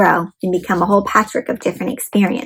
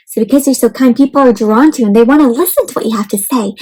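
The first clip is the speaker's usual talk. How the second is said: usual speaking voice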